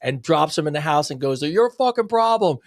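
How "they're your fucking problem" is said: higher mock-angry outburst; pissed-off father. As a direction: imitate an irritated dad dumping the problem. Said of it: "the father gets pissed off".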